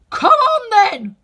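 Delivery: slow, loud, high royal roar; Queen roaring. Acted. The Queen roaring at Alice to join, slow. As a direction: roar the order. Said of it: "roared the Queen".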